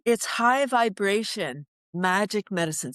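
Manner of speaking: usual speaking voice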